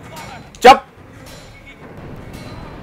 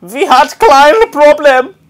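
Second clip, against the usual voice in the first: high, loud, slow mock German; hammed-up German phrase, drawn out; German accent; 8 semitones higher, 5 dB louder than usual. Gamer showing off his German, hammed-up German accent. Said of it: "That's the extent of my German".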